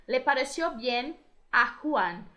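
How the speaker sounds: "Le pareció bien a Juan" spelled out slowly word by word; slow it down so the word order is clear; slow, deliberate model sentence. Shown as a demonstration. Teacher showing students the Spanish word order, slow and deliberate. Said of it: "essa expressão pode ser trocada, né, de ordem".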